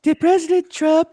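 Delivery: very high, sugary mock-reporter voice; squeaky, gushing parody of a softball questioner; fawning reporter. New media reporter lobbing the president's press secretary softballs, fawning squeak. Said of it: "new media in there that say".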